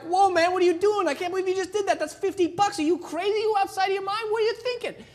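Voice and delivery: high, loud panicked yelling; freaked-out city boy. Retold or imitated. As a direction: shriek at him in disbelief. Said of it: "Dave's like".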